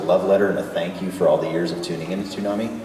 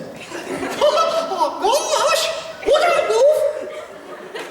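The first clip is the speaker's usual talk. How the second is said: very high cartoon laugh and exclaiming; Mickey Mouse. Answering a little girl's request, high cartoon mouse voice. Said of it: "Can you do a Mickey Mouse accent?".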